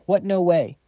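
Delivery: shocked outburst. Acted. Slams the table in shock.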